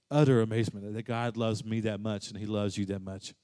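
normal talking voice

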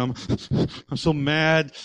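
ordinary talk, nothing marked

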